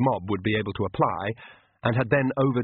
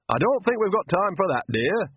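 put-on higher old-woman voice, sweetly; fake granny voice; 9 semitones higher than usual. Vampire posing as Nanny to Magrat, higher sweet granny voice. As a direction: coax her like a kindly old granny, pitched up. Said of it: "said the voice".

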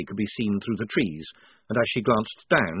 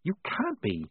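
hushed, higher-pitched hiss; 7 semitones higher, 7 dB quieter than usual. A narrator's reading. Polly to Maledict while Shufti sleeps, hissed. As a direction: hiss it softly in disbelief. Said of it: "she hissed".

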